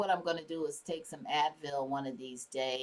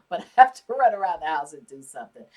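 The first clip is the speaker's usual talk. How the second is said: laughing; 2 semitones lower, 11 dB louder, faster than usual. Real, not acted. Laughing about messy house.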